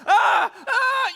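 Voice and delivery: high, loud screams of panic; acted scream. Man stuck in his sleeping bag, panicked screaming. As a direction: scream like you're trapped. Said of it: "you know, I'm screaming".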